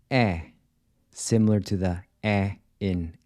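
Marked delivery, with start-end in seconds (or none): 0.1-0.4 E like the E in air; an open E with the mouth wide. Shown as a demonstration. Teacher models the Portuguese open E. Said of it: "the open E is pronounced".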